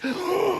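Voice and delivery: put-on high panicked little-kid gasp; childish gasp. Kid falling in the frozen pool, high gasp. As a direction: gasp like a kid hitting icy water. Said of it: "doing my best eight-year-old impression".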